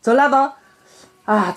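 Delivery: ordinary talk, nothing marked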